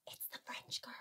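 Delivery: breathy conspiratorial whisper. Real, not acted. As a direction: whisper it like a secret. Aside about the French girls.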